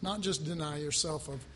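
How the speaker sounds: ordinary talk, nothing marked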